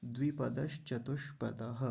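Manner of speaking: 'द्विपदश चतुष्पदः' as one phrase; no break in 'द्विपदश चतुष्पदः'; joined up. Shown as a demonstration. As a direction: link 'द्विपदश' straight into 'चतुष्पदः'. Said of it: "सभी को मिला देंगे पूरे को".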